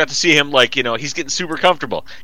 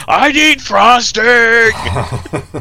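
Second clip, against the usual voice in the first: put-on higher, very slow, drawn-out pleading kid voice; mock whiny kid; 9 semitones higher, 5 dB louder, much slower than usual. Imitating a greedy kid craving frosting, drawn-out and whiny. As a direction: stretch it out like a greedy child.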